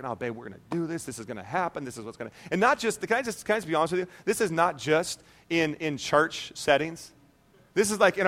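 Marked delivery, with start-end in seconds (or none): 0.0-2.3 low, soft reassurance to wife; calm her down quietly, voice dropped low; retold soothing murmur; reassuring husband.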